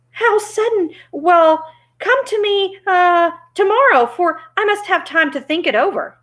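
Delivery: high-pitched, flustered stalling. Acted. Oz puts off the visitors, high and flustered.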